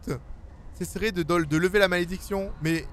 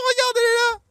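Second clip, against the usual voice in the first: high-pitched, very loud yell; excited shriek; 7 dB louder than usual. Real, not acted. Spotting the little girl already inside, excited shriek. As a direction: shriek it out in alarm.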